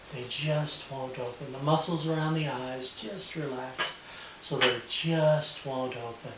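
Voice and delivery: soft voice. Real, not acted. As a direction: lull them down gently. Live induction on subject.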